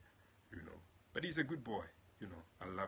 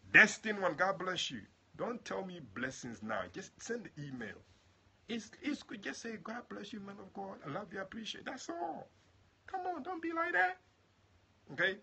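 much higher, loud, eager coaxing; excited pleading; 12 semitones higher, 9 dB louder than usual. Real, not acted. Preacher begging a viewer to email him, excited high pleading. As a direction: squeal at them to send the email.

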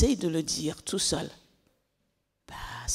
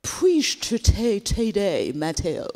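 a hint of an English girl's French, slow; clumsy French with English vowels; English accent; 2 semitones higher, much slower than usual. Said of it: "elle prononce mal".

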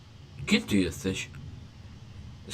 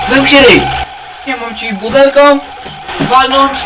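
loud, higher furious shout; angry yell; 6 semitones higher, 7 dB louder than usual. Real, not acted. Gamer yelling at the game child to shut up. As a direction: bark it to shut him up.

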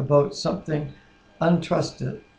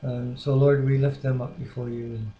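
soft, reverent spoken prayer; 3 semitones lower, 4 dB quieter than usual. Acted. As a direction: lead the prayer gently and sincerely. Husband leads prayer.

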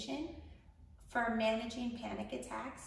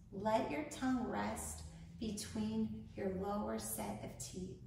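unhurried instructions, leaving room to breathe; guided meditation; 4 dB quieter than usual. Acted. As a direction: guide a panicked listener calmly through each breath.

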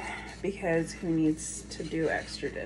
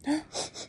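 mock gasp; 7 semitones higher, 6 dB quieter than usual. Real, not acted. Mother reacts to "hate.

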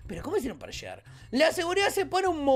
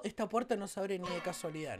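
ordinary talk, nothing marked

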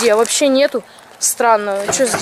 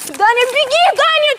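calling out; 10 semitones higher than usual. Real, not acted. Calling to friend, fleeing.

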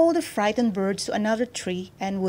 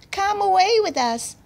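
high, lilting little voice; butterflies; 11 semitones higher, 4 dB louder than usual. A narrator's reading. Butterflies inviting the girl away, high little voice. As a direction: call invitingly, pitched high. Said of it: "said the butterflies".

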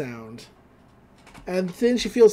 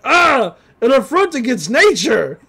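put-on loud, booming outraged girl; gruff Grinda; 4 semitones higher, 6 dB louder than usual. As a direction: bellow it like a big brute. Grinda voiced in recap.

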